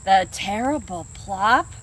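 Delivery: slow, low, worried fox; fox in fear. Acted. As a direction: wonder it aloud, uneasy and slow. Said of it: "thinks the fox in fear".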